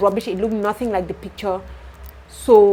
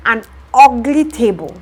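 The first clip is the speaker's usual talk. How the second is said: indignant repeat; 3 semitones higher, 9 dB louder than usual. Real, not acted. Repeating the complaint, louder.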